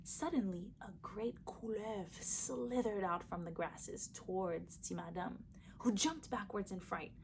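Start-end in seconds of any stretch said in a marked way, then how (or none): none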